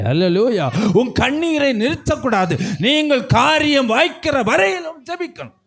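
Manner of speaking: high, urgent sermon delivery; impassioned pulpit exhortation; fervent preaching. Real, not acted. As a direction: preach with rising fervour, pressing each phrase home. Preaches at raised intensity.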